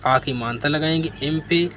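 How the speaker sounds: ordinary talk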